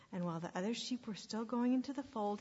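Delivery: plain reading voice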